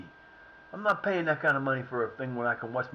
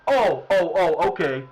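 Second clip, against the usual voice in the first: 1 sarcastic oh okay; 3 semitones higher, 9 dB louder, slower than usual. Real, not acted. Sarcastic at referee call.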